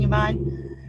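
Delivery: usual speaking voice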